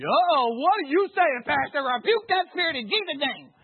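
scandalized congregant calling out from the pews; shocked churchgoer. Acted. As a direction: play a startled member of the flock rebuking the pastor. Plays shocked congregation member.

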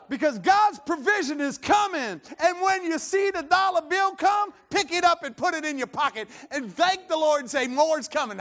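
loud, high revival preaching; shouted exhortation, punching each phrase; full-force preaching. Real, not acted. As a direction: fire up the congregation.

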